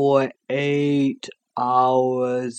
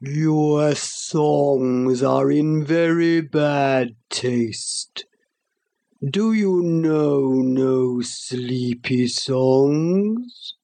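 sleepy slobbery voice; much slower than usual. Acted. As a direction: drag every word out half asleep. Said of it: "a great, sleepy, slobbery voice".

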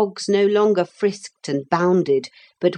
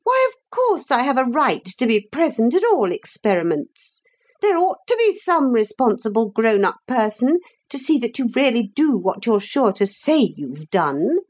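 high-pitched officious lecture; hateful, self-righteous lady; 7 semitones higher than usual. A narrator's reading. Motor lady demanding to supervise the children, high and officious. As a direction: insist on your rights in a high voice.

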